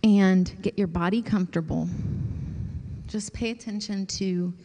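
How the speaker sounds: soft, unhurried voice easing them into stillness; guided meditation. Acted. Pastor guiding the group into quiet prayer, soft meditation voice. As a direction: let each phrase settle before the next.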